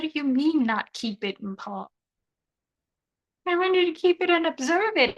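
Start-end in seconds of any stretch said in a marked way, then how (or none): 3.5-5.2 whiny protest. Real, not acted.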